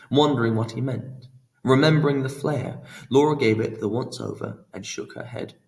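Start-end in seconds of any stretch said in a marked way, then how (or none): none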